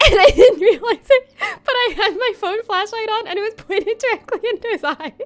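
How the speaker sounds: giggling helplessly at her own blunder; laughing. Real, not acted. Streamer to chat about the Uber flashlight, laughing.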